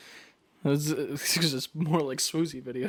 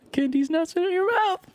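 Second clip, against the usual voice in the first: squeaky, much higher punchline voice; high-pitched meme delivery; 3 dB louder than usual. Shown as a demonstration. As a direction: jump way up high to sell the meme. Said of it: "I can do the meme".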